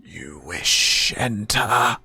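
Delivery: slow, rasping spirit question; slow undead voice. Acted. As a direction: ask it like a gatekeeping ghost. Door spirit asks.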